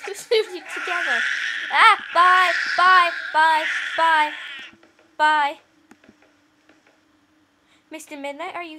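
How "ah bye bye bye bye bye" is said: loud, sing-song bye bye; loud sing-song goodbye. Pretend. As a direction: call bye bye out loud like a child. Waving goodbye to dolls.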